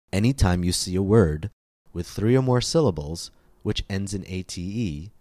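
ordinary talk, nothing marked

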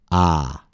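"ah" met de tong naar achteren, kaak omlaag; "ah" open en achterin; ah vowel. Shown as a demonstration. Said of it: "Please listen and repeat".

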